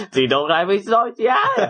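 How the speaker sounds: hammed-up Tohoku old woman accent; Tohoku granny. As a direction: play a rural Tohoku grandma you can't understand. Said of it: "東北のおばあちゃんとか".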